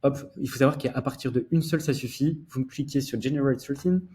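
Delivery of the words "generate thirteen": Generate thirteen said with a mock English accent. Shown as a demonstration. Host showing off his English accent to viewers, mock English accent. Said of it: "Attention, l'accent anglais".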